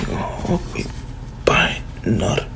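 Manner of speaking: soft whispered goodbye. Retold or imitated. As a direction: breathe the farewell shyly. Hinata's shy quiet goodbye.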